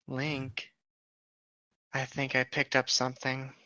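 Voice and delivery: hushed warning. Acted. Wife warning Link of a scent across the lake, hushed. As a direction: lean in and warn him softly. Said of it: "tiger woman sniffing danger".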